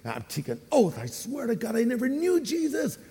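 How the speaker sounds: lightly acted denial, soft and climbing high; hushed oath rising in pitch; Peter. Disciple swearing he never knew Jesus, acted protest. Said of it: "So here's Peter".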